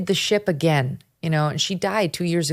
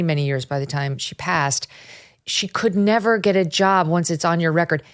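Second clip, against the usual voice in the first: normal talking voice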